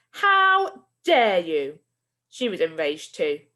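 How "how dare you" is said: loud, very slow outraged teacher; furious shout again. Acted. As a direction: drag each word out in fury. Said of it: "shouted Missus Collins".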